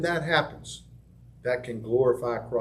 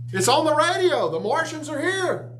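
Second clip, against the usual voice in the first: mock panic; 13 semitones higher than usual. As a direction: cry out like a fooled listener in alarm. Panicked radio listeners.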